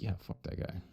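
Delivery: hushed, voiceless friend's aside; conspiratorial whisper. Pretend. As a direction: whisper it like a loyal friend taking sides. Voicing Ishtar's friend.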